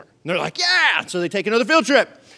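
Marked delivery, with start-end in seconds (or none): 0.6-1.0 high, loud burst of keenness; eager cheer. Acted. Disciples answering Jesus about another field trip, eager shout. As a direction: shout it like a keen pupil.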